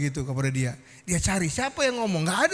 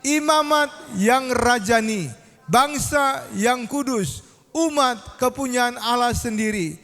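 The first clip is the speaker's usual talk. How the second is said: slow, high, loud recitation; raised, stretched-out reading voice; congregational scripture reading; 5 semitones higher, 5 dB louder, slower than usual. Acted. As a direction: lead the crowd through the verse, phrase by measured phrase.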